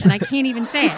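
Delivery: ordinary talk, nothing marked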